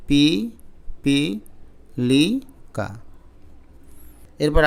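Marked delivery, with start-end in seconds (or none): 0.1-2.9 slow, syllable by syllable, pausing between each; spelled out syllable by syllable. Shown as a demonstration. Teacher spelling the word slowly to students, syllable by syllable. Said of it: "একইভাবে".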